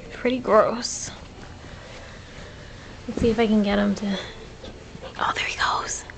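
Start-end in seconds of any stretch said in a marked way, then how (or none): 5.2-6.0 soft running whisper. Real, not acted. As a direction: whisper the commentary. Hushed running commentary on pets.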